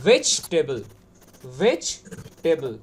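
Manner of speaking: ভেজিটেবল as "VECH-tebl", then broken in two; low model of ভেজিটেবল without the "i"; correct pronunciation. Shown as a demonstration. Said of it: "ওটা হচ্ছে".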